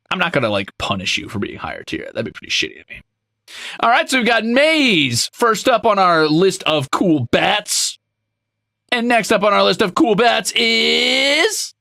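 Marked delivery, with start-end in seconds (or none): 3.8-11.7 game show host. Acted. Announcing list like host.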